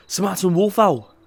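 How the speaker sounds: high, loud eager answer; eager guess. Acted. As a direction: blurt the answer out proudly. Casimir eagerly guesses the bird.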